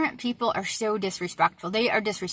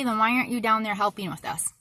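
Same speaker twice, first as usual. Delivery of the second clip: normal talking voice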